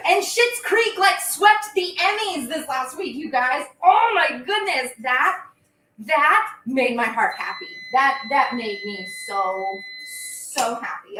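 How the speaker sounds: high, loud, laughing delight; bubbling over with joy; thrilled. Real, not acted. Host gushes to viewers about the Emmys, high, loud and laughing.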